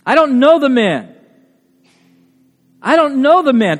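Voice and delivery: loud, high-pitched flat denial of knowing him; emphatic oath. Acted. Peter denying Jesus to bystanders, emphatic oath. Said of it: "he denied it with an oath".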